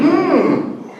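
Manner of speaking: high rising mm of delight; approving mm. Shown as a demonstration. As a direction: hum like a bright idea just landed. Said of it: "when we get a good idea, what do we say".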